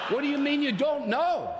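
mock disbelief from the angel at the gate; mock incredulous angel. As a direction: play the baffled angel, lightly. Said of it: "the angel must have said".

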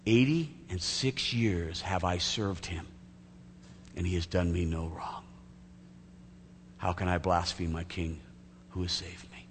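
slow, low, soft solemn declaration; hushed, measured testimony of faith; deep reverence. Acted. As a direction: speak quietly and reverently of a beloved king. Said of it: "with a deep countenance and a reverence for Jesus".

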